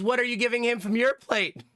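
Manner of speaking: high, pointed question from a caretaker; suspicious teacher. Retold or imitated. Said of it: "Lady Mac goes".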